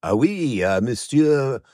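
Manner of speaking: put-on over-the-top French accent, slow and loud; mock French accent. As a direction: play the Englishman putting on a French accent for the waiter. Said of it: "speaking to French people loudly with a French accent".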